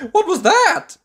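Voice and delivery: high, loud panicked shriek; terrified shriek. Acted. Mister Jelly hearing a twig snap, shrieking. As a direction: scream it in sudden fright. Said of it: "he shrieked".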